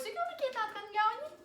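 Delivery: mock dramatic. Telling viewers the bug is winning, high and dramatic. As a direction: ham up the hopeless fight.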